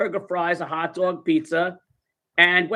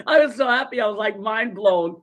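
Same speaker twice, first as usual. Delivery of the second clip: excited; 4 semitones higher, 3 dB louder than usual. Retold or imitated.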